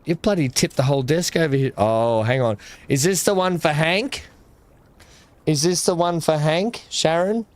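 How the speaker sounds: usual speaking voice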